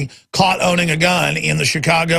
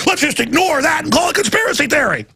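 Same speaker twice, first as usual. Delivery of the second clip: sarcastic shout; 8 semitones higher than usual. Acted. Host mocking dismissers, sarcastic shout.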